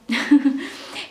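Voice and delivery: short laugh through tears; small embarrassed laugh; teary laugh. Real, not acted. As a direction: laugh off the emotion.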